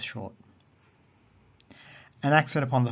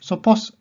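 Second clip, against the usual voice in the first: "Sopos" with POS high and loud; stress on last syllable; 9 semitones higher, 11 dB louder than usual. Shown as a demonstration. Teacher showing last-syllable accent, "Sopos" stressed on POS. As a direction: punch the final POS of "Sopos" upward. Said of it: "the accent is upon the ultima".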